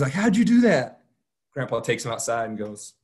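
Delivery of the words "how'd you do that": high, loud amazed question. Retold or imitated. Robbie asks grandpa how he found it, high and amazed. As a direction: blurt it out in wonder.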